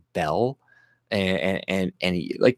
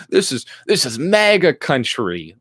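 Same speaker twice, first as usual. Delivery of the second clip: mocking laugh; 4 semitones higher than usual.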